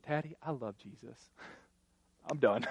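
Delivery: put-on soft, matter-of-fact child voice; a hint of a satisfied four-year-old; lightly voiced little girl. Daughter telling dad she loves Jesus, soft child voice. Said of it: "my daughter looked me in the eyes and said".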